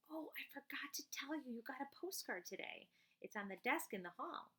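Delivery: a hint of a forgetful mom; light mom voice. Mom's line voiced in story.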